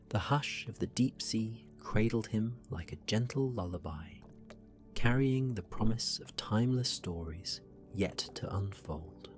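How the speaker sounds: ordinary delivery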